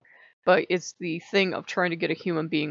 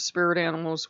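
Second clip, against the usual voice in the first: ordinary talk, nothing marked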